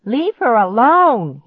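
high, loud shriek. A narrator's reading. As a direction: shriek it, frantic. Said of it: "he shrieked".

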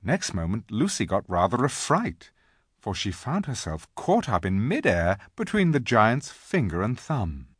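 ordinary delivery

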